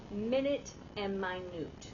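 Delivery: MIN-it, then my-NOOT for "minute and minute"; "minute and minute" as two different words, MIN-it and my-NOOT; contrasting pronunciations. Shown as a demonstration. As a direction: say "minute and minute" as time unit, then tiny. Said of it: "you say it differently".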